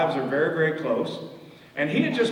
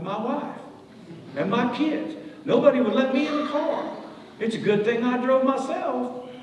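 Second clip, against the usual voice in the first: high whiny complaint; 11 semitones higher than usual. Retold or imitated. Dad's self-pitying complaint.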